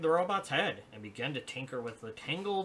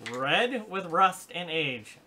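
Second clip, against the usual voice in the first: slow, ominous storyteller voice; slow dramatic narration; 5 semitones higher, 8 dB louder, much slower than usual. A narrator's reading. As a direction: draw it out like a spooky tale.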